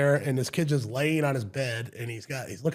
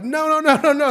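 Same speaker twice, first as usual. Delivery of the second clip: high, loud string of urgent denials; rapid-fire high yelling; panicked protest; 14 semitones higher, 10 dB louder than usual. Retold or imitated. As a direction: cut in loudly to stop a false accusation. Retold panicked protest to the kid.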